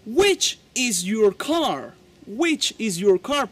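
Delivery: slow, careful English model question; slow model sentence. Shown as a demonstration. Teacher modeling slowly for Spanish learners. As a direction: say it slowly for students to copy. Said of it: "y tú le preguntas a él".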